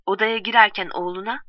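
plain reading voice

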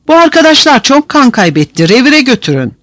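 high-pitched appeal for help; urgent plea. A narrator's reading. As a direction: plead urgently for the wounded. Prisoner pleads for wounded comrades.

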